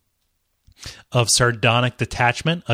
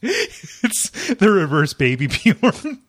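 laughing while talking; 7 semitones higher, slower than usual. Real, not acted. Laughing through the words.

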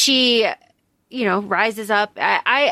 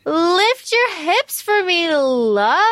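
slow, higher, drawn-out romance line; mock-seductive quote; 6 semitones higher, much slower than usual.

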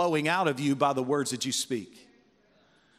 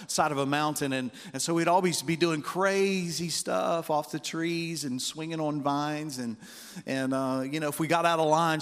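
ordinary delivery

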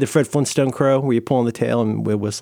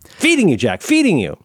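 quoted outburst; 5 semitones higher than usual. Acted. Sudden quoted movie line.

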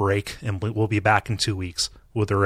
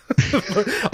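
hiccup giggles; 11 semitones higher than usual. Real, not acted. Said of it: "I'm gonna start hiccuping".

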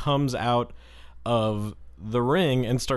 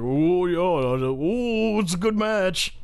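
mock crowd; 6 semitones higher, slower than usual. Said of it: "everybody's like".

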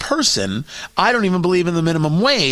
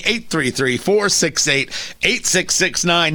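ordinary talk

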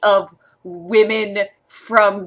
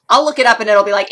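normal talking voice

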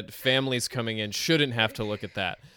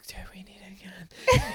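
whispered, laughing imitation; mock breathy question; 7 semitones higher than usual. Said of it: "she goes".

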